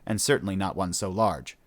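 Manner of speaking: ordinary delivery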